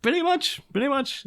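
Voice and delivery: high, sing-song pretty much; amused agreement. Real, not acted. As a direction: chuckle it up high. Wry agreeing to joke.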